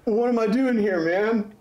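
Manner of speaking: high, bewildered dude voice; out-of-his-depth slacker guy; lost dude. Acted. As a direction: play him confused, pitched well up. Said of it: "he says".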